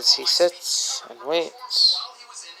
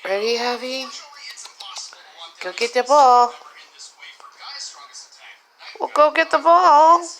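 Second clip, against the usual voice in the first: high, loud urging to fetch; loud high-pitched play call; excited call; 10 semitones higher, 10 dB louder than usual. Real, not acted. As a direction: rile the cat up to chase the ball. Calling the cat to play.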